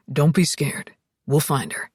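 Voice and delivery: low, steady man's voice; calm her in a low voice; deep, steady reassurance; reassuring Alex. A narrator's reading. Alex reassures frightened Zoe.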